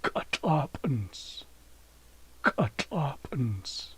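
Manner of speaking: Katlapens drawn out slowly, syllable by syllable; slow Haisla demo word. Shown as a demonstration. Teacher slowly modelling Katlapens for viewers. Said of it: "That is Saturday".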